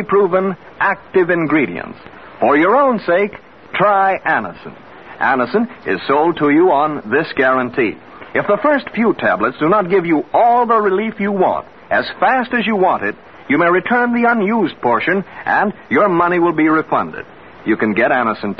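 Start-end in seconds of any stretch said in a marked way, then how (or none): none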